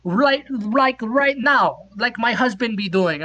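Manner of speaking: the Norwegian woman pushing her offer; mock Norwegian accent. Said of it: "this accent is".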